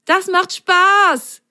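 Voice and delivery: high, loud, slow jubilant cry; high loud drawn-out cheer. Acted. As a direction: shout it out with pure joy. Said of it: "jubelte Himmelblau".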